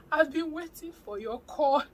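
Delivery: high, loud, overjoyed re-enactment; hammed-up joyful cry; retold excited outburst. Retold happy outburst to caller.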